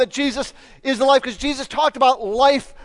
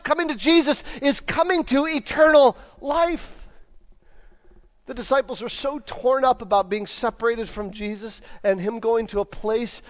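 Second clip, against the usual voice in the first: ordinary talk, nothing marked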